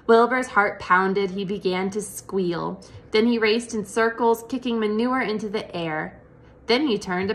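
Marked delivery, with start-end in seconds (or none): none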